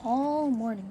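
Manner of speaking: ordinary delivery, nothing marked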